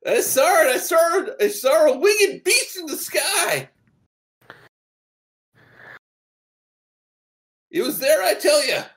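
put-on high, loud, frantic old-timer shout; old prospector. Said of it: "pointing at the sky and go".

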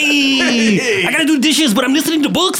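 hammed-up Mexican accent. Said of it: "Do it in Mexican accent".

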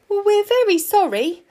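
high, loud startled apology; flustered fox. A narrator's reading. Fox apologising to a talking stone lion, flustered.

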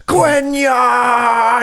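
high, hammed-up shout; dying scream. Guard crushed by magic vines, mock death scream.

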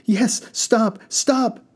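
high, pleading cry; begged. A narrator's reading. Frightened passengers begging the driver to stop. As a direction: beg him to slow down. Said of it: "the passengers begged".

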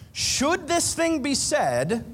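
high, slow, leaning on "this"; pointed question. Shown as a demonstration. Pastor to couples, slow pointed self-question. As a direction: ask "Should this thing be said?" slowly and pointedly. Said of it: "the two questions you wanna ask".

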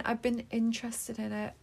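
usual speaking voice

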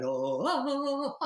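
high swooping "oh" into laughing; Tarzan laughter. Shown as a demonstration. Laughter coach demonstrating the swinging game, Tarzan laughter. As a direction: whoop like Tarzan, then laugh. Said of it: "maybe they're doing Tarzan laughter".

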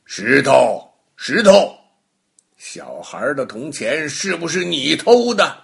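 loud call to the stone, then firm question; stern questioning. Acted. Baogong interrogating the stone, loud stern call. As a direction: magistrate demanding an answer from a stone. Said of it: "包公对那块石头说".

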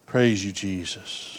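soft, slow murmured prayer; quiet prayer. Real, not acted. Preacher thanks Jesus after altar call, soft and slow. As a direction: pray it gently under the breath.

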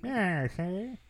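put-on vintage movie villain voice; old-time villain. Showing how every villain sounded before the seventies, villain voice. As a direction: do a hammy old-film bad guy. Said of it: "that's how every villain sounded".

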